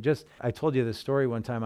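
ordinary talk

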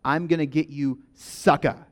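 "sucka" said as SUCK-uh, the way Hebrew sukkot sounds; pop-movie line to show how "sucka" sounds; sucka pronunciation. Shown as a demonstration. Pastor showing the church how sukkot sounds, saying "sucka" as SUCK-uh. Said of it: "but it's pronounced like".

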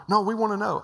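high, loud insistent pleading; eager begging. Retold or imitated. Executives begging the preacher for his source, high loud insistence. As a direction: push like executives who must know.

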